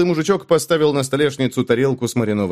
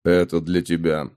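low gruff man; 7 semitones lower than usual. Acted. Hero hands innkeeper letters.

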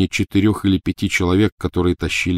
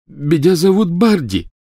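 talking through a bloody mouth; slurring past blood; mouth full of blood; 11 semitones higher, 6 dB louder than usual. Acted. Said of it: "полон рот крови".

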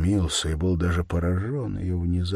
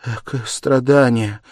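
higher, louder burst of pity; agonized wail; 6 semitones higher, 8 dB louder than usual. A narrator's reading. Sonya seeing his suffering, an agonized cry. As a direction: let a pained cry escape. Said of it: "вырвался мучительный вопль у Сони".

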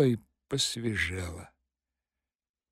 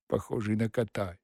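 plain reading voice

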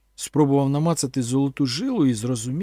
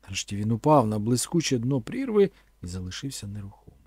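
ordinary delivery

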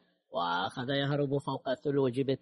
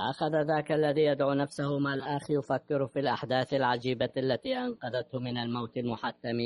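ordinary delivery, nothing marked

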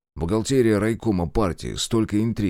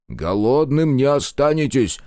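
убеждённо; 6 semitones higher, 5 dB louder, slower than usual. Acted. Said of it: "убеждённо произнёс Марков".

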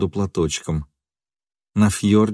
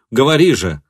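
raised, loud plea to speak; urgent demand; 4 semitones higher, 5 dB louder than usual. A narrator's reading. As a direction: press her for the answer.

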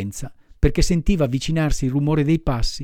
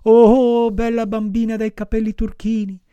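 calling out; 8 semitones higher, 3 dB louder than usual. A narrator's reading. Pinocchio calls to the blue-haired girl, imploring cry. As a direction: call up to the window, begging. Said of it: "gridava Pinocchio".

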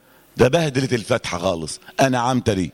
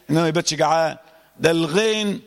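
normal talking voice

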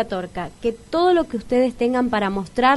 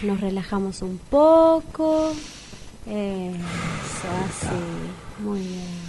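much softer, lower and gentle, calming someone down; soothing; 5 semitones lower, 4 dB quieter, much slower than usual. Acted. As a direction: talk him down softly as he sips. Calming a choking man down. (tranquilizadora, mucho más suave y grave)

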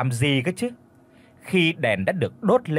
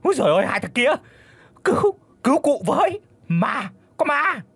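much higher, loud terrified cry for help; panicked scream; 11 semitones higher, 4 dB louder than usual. A narrator's reading. Old master shrieking for his servants to save him. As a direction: shriek for help, seized by a ghost. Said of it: "Hoảng quá cụ hét lên".

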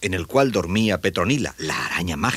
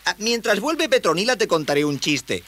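put-on high young voice; high-pitched, helpful youngster; eager boy; 13 semitones higher than usual. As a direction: offer it in a high boyish voice. Boy offers a joke.

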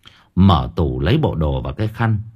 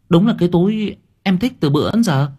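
delighted; 10 semitones higher than usual. Acted. Girlfriend delighted by gift.

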